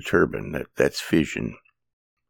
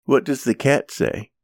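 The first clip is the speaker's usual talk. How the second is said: much higher singsong kiddie question; reading to a toddler; 8 semitones higher than usual. Pretend. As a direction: quiz a toddler on animal sounds. Children's book animal sounds.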